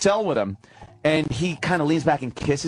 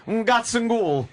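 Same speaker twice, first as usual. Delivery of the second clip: mock Italian; 7 semitones higher, much slower than usual. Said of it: "impression of Mussolini".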